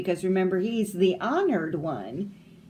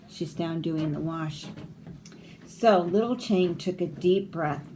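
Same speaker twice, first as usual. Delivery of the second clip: ordinary delivery, nothing marked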